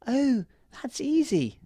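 very high, airy-light fairy reply; tiny voice, slow and very high; little fairy. A narrator's reading. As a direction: reassure in a small, squeaky fairy voice. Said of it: "said the fairy".

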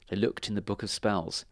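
usual speaking voice

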